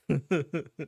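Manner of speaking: short real chuckle. Real, not acted. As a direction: chuckle at your own remark.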